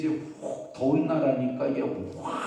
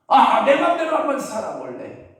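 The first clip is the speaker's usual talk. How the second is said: high, loud burst of defiance; rebellious son; 13 semitones higher, 7 dB louder than usual. Acted. Younger son breaking away from his father, hammed-up defiant outburst. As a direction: cry out for freedom, drawing out the first sound.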